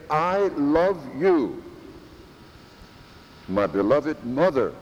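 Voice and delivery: mean every word of it; intense. Shown as a demonstration. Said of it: "with the intenseness".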